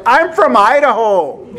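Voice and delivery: high-pitched mock country boy accent. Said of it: "that country boy accent".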